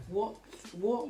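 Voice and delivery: ordinary talk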